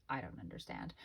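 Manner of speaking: mock-deflated "I don't understand" to the agent; deflated confusion. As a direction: let it sink low and lost. Confused, deflated quote of herself.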